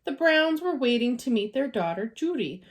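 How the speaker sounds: plain reading voice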